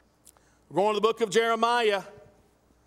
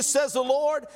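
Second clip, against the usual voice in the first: usual speaking voice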